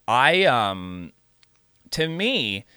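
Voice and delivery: ordinary delivery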